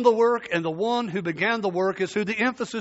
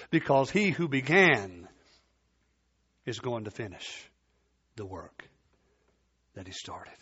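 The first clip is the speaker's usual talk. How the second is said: hushed promise; 8 semitones lower, 5 dB quieter than usual. Real, not acted. Preacher drops to hushed promise.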